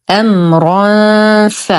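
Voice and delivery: "أمرا ف" recited slowly, nasal hum held before the ف; full nasal ikhfa. Shown as a demonstration. Teacher shows learners hidden noon before faa, long nasal recitation. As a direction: hold the hum on the end of "أمرا" into ف. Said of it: "نقول".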